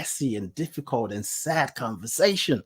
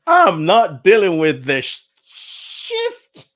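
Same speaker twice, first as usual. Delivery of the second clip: loud, high, drawn-out refusal; exasperated, pitched-up protest; fed-up outburst; 4 semitones higher, 8 dB louder, slower than usual. Retold or imitated. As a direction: refuse point-blank, stretching and catching the swear word. Acts fed-up outburst, censored swear.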